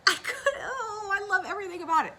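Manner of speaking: high, loud bursts of delighted laughter; gushing with delight. Real, not acted. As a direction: squeal over how much you love it. Gushing over her own pattern.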